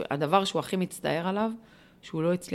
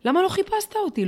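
the plea pushed much higher and louder on לא; rising plea; 13 semitones higher, 5 dB louder than usual. Retold or imitated. Daughter pressing her father for answers, a higher, louder plea. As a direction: break upward, demanding on לא. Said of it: "מבקשת ממנו תשובות".

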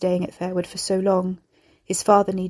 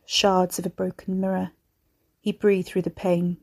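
ordinary delivery

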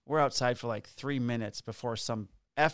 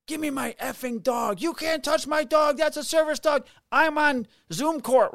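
high, loud outraged woman; retold angry yelling; 14 semitones higher, 5 dB louder than usual. Dog owner yelling at police for her dog, high mock yell. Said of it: "And she's yelling at us".

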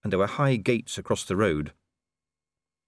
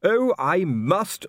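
mocking; 10 semitones higher, 5 dB louder, much slower than usual. Colon mocking "must.

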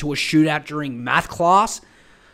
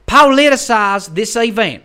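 mock gun-rights advocate; 3 semitones higher, 5 dB louder than usual. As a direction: parody an American gun believer, starting at full indignant volume. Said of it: "all these people being like".

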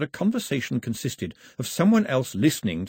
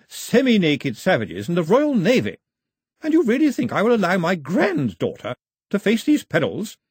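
higher, louder scornful matriarch; scornful grandmother; 8 semitones higher, 5 dB louder than usual. Acted. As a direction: turn his words back at him. Grandmother mocks his list.

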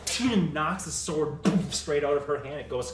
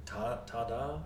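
awkward ta-da; 4 semitones lower, 5 dB quieter than usual. Acted. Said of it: "Looking at you really awkward".

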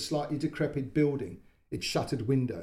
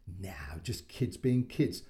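soft whispered reassurance; 6 semitones lower, 3 dB quieter, much slower than usual. Acted. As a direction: hush it kindly, slowly. Neighbour softly reassures mother.

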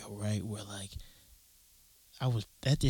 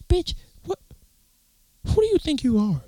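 indignant outburst. Retold or imitated. Neighbor told him to slow down.

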